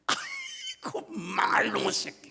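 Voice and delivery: 길고 높게 뽑은 "아이고"로 시작하는 엄마 흉내; 너무 예뻐 못 견디듯 욕하기. Retold or imitated. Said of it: "그 이쁜 이걸 표현하는데 뭐라 그런 거 아니?". (깨물어주고 싶은 듯한 애정 섞인 욕)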